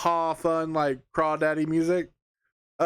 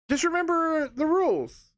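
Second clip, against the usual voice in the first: catchphrase sign-off; 12 semitones higher than usual. Acted.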